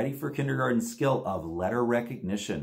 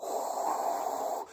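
blowing sound; 8 dB quieter than usual. Shown as a demonstration. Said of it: "can you blow really hard?".

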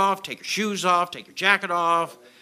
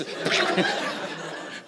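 breathy relief laugh. Real, not acted.

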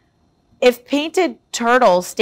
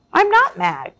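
bright, higher cheery dog voice; cheerful growl; 9 semitones higher than usual. Acted. Jake tells the birds he isn't mad, cheerful voice. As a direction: protest brightly, happy not mad. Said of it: "Jake growled cheerfully".

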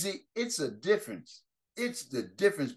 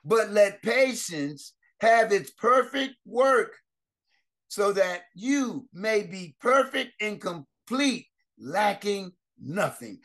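slow scripture reading; 4 semitones higher, slower than usual. Acted. Scripture read slowly, louder.

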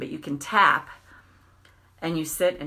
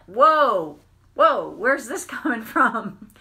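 louder, higher whoa, then a laugh; startled whoa; 3 semitones higher, 6 dB louder, slower than usual. Retold or imitated. Retelling waking at night, startled and laughing. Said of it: "I woke up the other night and I'm like".

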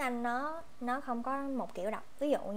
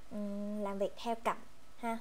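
ordinary talk

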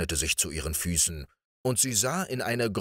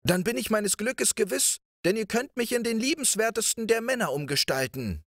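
much higher eager prince; prince calls louder. A narrator's reading. Riquet calls to the princess, much higher and eager. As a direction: call out, eager and triumphant. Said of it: "rief Riquet mit dem Schopf noch lauter".

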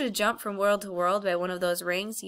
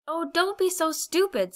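high, far scornful snap; scornful girl; 10 semitones higher than usual. A narrator's reading. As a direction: scoff at him impatiently. Polly scolds Diggory.

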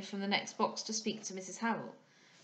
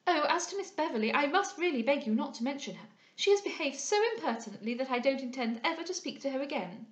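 high-pitched, huffy society lady; prattling young lady, affronted; 4 semitones higher, 3 dB louder than usual. Acted. Young lady refusing to discuss her rival, affronted. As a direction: chatter indignantly in a raised pitch. Voices Miss Larolles.

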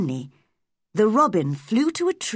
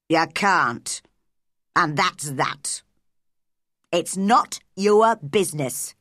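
put-on curt old Yorkshire gardener's refusal; unsmiling; 3 semitones lower, 3 dB louder than usual. A narrator's reading. Ben sends Mary away to play, unsmiling and gruff. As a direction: shut her out firmly, no smile left. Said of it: "Ben stopped smiling".

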